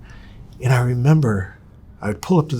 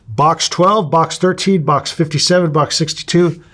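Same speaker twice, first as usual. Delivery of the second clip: loud, high rattled-off list of boxes; triumphant roll call, raised voice; calling out numbers; 8 semitones higher, 6 dB louder, slower than usual. Retold or imitated. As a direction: call each box out emphatically, pitched up. Reads box numbers out to doubters.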